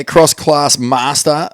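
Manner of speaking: normal talking voice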